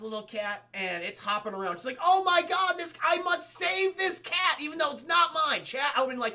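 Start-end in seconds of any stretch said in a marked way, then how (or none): 2.0-5.6 high, loud hammed-up heroine; mock melodramatic vow. Heroine spotting a stray cat, mock melodrama. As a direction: declare it with overblown urgency.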